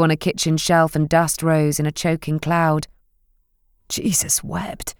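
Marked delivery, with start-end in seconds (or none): 3.9-5.0 slow, soft mutter under the breath. Acted. Finn swearing softly at the dusty kitchen, slow mutter. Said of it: "he muttered under his breath".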